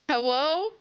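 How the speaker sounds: ordinary delivery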